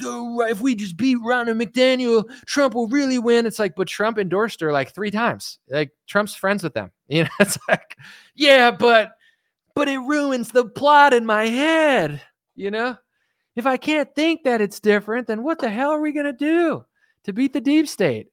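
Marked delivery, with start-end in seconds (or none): 0.0-3.5 higher, naive mocking voice; mock believer voice. 8.3-18.3 higher, whiny naive believer; mocking high voice.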